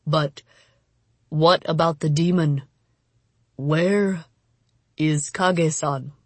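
halting, weary man's questions; wounded samurai. A narrator's reading. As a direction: ask with long pauses, weakened.

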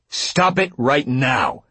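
sharp, high, slow order; stern command, each word drawn out; whip-snap command. A narrator's reading. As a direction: crack it out like a whip. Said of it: "The whip snap of his tone".

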